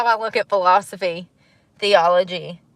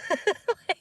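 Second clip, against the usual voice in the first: high giggle; 11 semitones higher, 8 dB quieter than usual. Real, not acted. Laughs at own joke.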